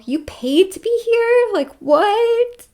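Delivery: high, loud incredulous question; astonished disbelief. Retold or imitated. Reacting to returning memories, high and astonished. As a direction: shoot the pitch way up in disbelief.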